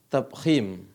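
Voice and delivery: ordinary delivery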